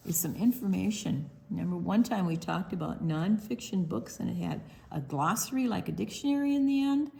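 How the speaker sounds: plain reading voice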